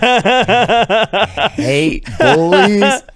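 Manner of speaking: drawn-out, laughing words; real laugh while ranting. Real, not acted. Laughing outburst.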